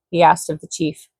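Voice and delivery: ordinary delivery, nothing marked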